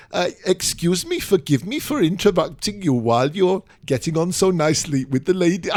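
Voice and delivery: put-on South Wales accent. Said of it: "very strong South Wales accent".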